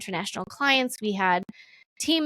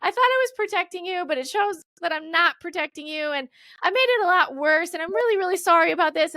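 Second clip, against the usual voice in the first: higher, pleading, heartfelt apology; emotional apology; 8 semitones higher than usual. Retold or imitated. To her crying team on the Zoom call, higher pleading apology. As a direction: open up and say sorry earnestly.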